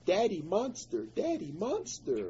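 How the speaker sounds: high, lightly voiced little child, half laughing; toddler voice. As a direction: mimic the toddler's sing-song naming. Toddler pointing at bloodied dad.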